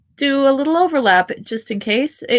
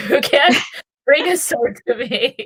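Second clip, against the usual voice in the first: giggling through the words; laughing riff; 3 semitones higher than usual. Real, not acted. Friend riffing on the song, laughing throughout. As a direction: laugh while riffing the lyric.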